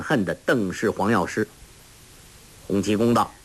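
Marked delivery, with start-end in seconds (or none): none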